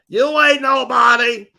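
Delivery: loud, high dismissive yell; shouted put-down. Pretend. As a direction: yell it at them like a heckler. Louder repeat of dismissal.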